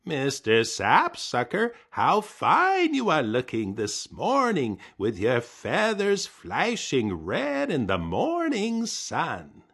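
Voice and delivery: high, slow sweet-talking voice; butter up the bird; oily high flattery; flattering. Acted. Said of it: "take a different approach".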